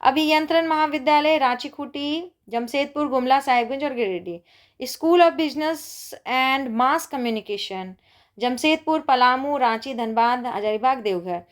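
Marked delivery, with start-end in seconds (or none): 4.9-7.9 slow dictation. Shown as a demonstration. Teacher reads institute name slowly.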